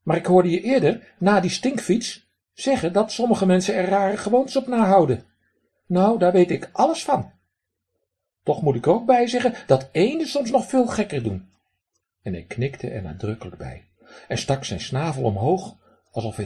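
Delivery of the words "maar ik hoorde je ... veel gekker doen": high, loud duck voice with a raspy quack. Acted. Drake lectures the man who threw pebbles, high raspy quack. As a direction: play a cheeky drake scolding a stranger.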